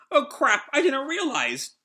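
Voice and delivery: high-pitched mock panic; alarmed. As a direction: voice jumps up in acted dismay. Acting Chopper's alarm at infection.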